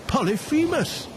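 high calling shout across the mountain. Acted. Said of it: "they shouted".

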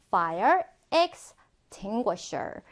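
"fire extinguisher" very slowly, broken into parts; drawn-out, careful "fire extinguisher"; slow pronunciation model. Shown as a demonstration. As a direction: space out "fire extinguisher" for learners to repeat. Teacher models word for repetition.

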